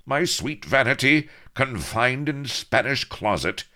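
high, loud, theatrical anguish; anguished cry. A narrator's reading. Avery lamenting his lady's confinement, anguished. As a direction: wail it like an outraged lover. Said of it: "cried Avery in anguish".

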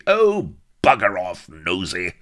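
explosive, high and loud outburst; exasperated yell, easing at the end; angry shout. A narrator's reading. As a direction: lose your temper and bellow. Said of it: "shouted Bilbo, his temper exploding".

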